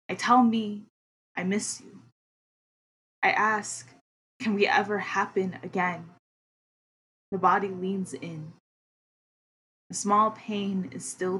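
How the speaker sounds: measured spoken word recitation; poem reading. Acted. Reads own poem aloud.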